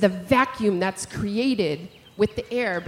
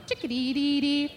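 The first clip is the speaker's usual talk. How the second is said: chickadee call; 5 semitones higher, 3 dB quieter than usual. Retold or imitated. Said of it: "the other one will go".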